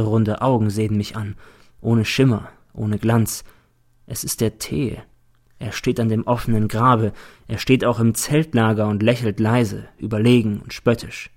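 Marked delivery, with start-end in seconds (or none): none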